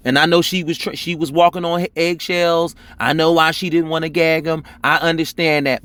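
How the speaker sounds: ordinary talk, nothing marked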